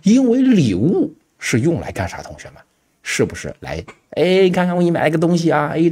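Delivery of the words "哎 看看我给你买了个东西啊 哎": higher, quick gift-giver's show-off; 献宝似的. Acted. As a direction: act out proudly presenting a gift, pitched up. Acting out showing someone a gift. (抬高嗓音、兴冲冲地献礼物)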